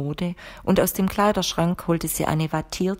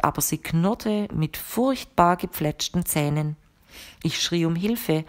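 plain reading voice

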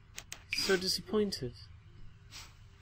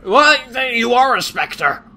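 much higher, loud startled cry; jolted by a sudden shock, shouting; 9 semitones higher, 11 dB louder than usual. Real, not acted. Startled by the Spectre.

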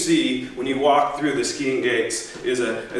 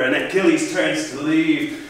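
ordinary delivery

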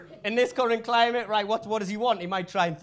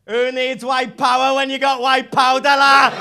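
gloating shout; 3 semitones higher, 8 dB louder than usual. Retold or imitated. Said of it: "turns back round and goes".

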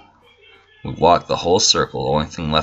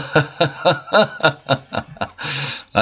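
long, high burst of laughter; laughing; 10 semitones higher than usual. Real, not acted. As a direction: laugh hard after a scare. Laughing off a sudden scare.

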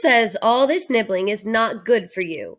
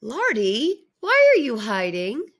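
usual speaking voice

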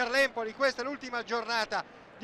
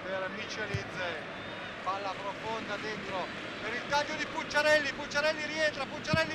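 ordinary talk, nothing marked

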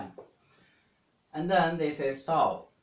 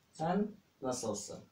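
"Sen" drawn out and pitched up, then falling; stress on SEN; 3 semitones higher, 4 dB quieter than usual. Shown as a demonstration. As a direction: lean on "Sen" to turn the question back. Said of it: "because you emphasize "sen".

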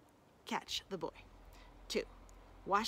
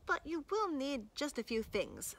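higher, sly singsong; coy caveat; 4 semitones higher than usual. Acted. Boy warning the hungry wolf, high and coy. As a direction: dangle the catch lightly.

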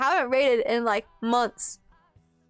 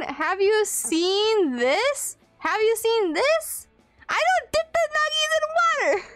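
incredulous; 8 semitones higher than usual. Real, not acted. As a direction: squeak it out in disbelief. Incredulous at viewer's claim.

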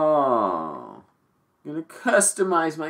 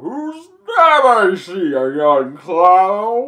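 high, slow ancient elder voice; old wise cloud; 8 semitones higher, 4 dB louder, slower than usual. Pretend. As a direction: play a grand, ancient sky elder. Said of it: "Why, I'm Papa Cloud".

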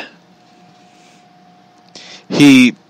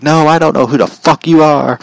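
exasperated retort; 5 semitones higher than usual. Retold or imitated. Imagined retort to the wrestler.

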